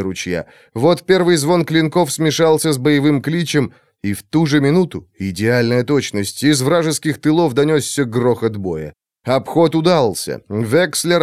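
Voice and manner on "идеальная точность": ordinary delivery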